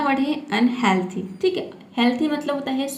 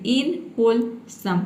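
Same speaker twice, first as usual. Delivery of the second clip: इनहोलसम very slow, in three separate pieces; broken into parts; much slower than usual. Shown as a demonstration. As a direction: say इनहोलसम slowly as in, hol, sum with gaps. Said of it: "तोड़ तोड़ के pronounce करेंगे".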